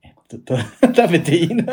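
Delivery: high, loud, laughing through the words; laughing awkward aside; awkward laughing question. Retold or imitated. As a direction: giggle out the embarrassed question. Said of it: "気まずいけどね".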